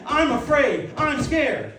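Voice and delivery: high, loud admission of fear; bold confession. Shown as a demonstration. Christians stepping up to confess fear, loud and high. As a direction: declare it out loud, unashamed. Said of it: "need to step up and say".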